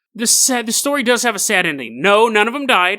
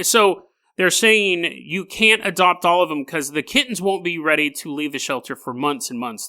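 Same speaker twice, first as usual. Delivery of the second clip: usual speaking voice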